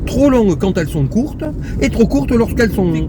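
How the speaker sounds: normal talking voice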